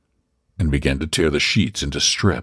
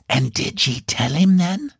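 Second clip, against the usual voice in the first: husky whisper; 12 semitones higher than usual. Acted. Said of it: "in a husky whisper, laden with mystery".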